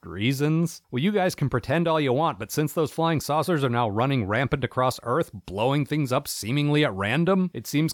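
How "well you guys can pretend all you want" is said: ordinary delivery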